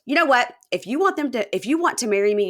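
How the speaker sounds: high, loud confrontational demand; demanding ultimatum. Retold or imitated. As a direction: force him to choose now. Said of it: "And Jen goes".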